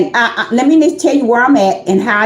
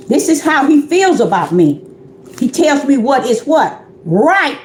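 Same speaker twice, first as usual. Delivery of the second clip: usual speaking voice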